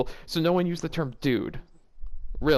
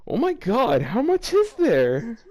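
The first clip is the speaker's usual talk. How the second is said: dismayed exclaim; 8 semitones higher, 5 dB louder, slower than usual. Real, not acted. Dismay at long text.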